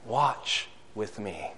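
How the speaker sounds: low, slow and soft, barely above a breath; Jesus begging his friends to stay near; hushed plea. Acted. Jesus asking disciples to watch, hushed and low.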